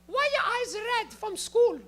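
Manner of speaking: put-on high, loud accented interrogation; imitated mom voice, high and pressing; immigrant mom. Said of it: "And she goes".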